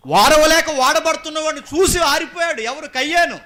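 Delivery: very high, very loud proclamation; shouted preaching. Acted. Preacher repeating why Cain was extinguished, shouted preaching. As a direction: cry it out at full force.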